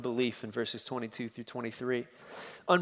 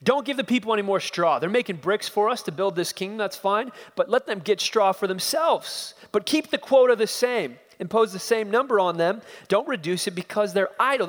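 louder, fast stern orders; harsh command; 6 semitones higher, 3 dB louder than usual. Acted. Pharaoh commanding the taskmasters, stern and harsh. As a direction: bark out the tyrant's orders. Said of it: "he issues a command".

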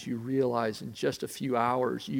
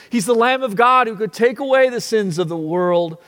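louder, higher, fervent preaching; preaching at full force; 6 semitones higher, 9 dB louder than usual. Acted. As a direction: proclaim it like a revival preacher.

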